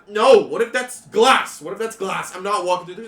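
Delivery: put-on panicky teen. GM voices scared teen.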